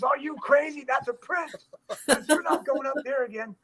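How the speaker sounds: very high-pitched, emphatic director; mock exclaiming refusal. Director telling stuntman not to jump again, mock exclaiming.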